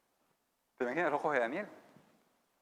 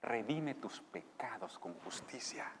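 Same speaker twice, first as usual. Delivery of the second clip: whisper; 3 semitones lower, 9 dB quieter, slower than usual. Acted. Whispered repeat.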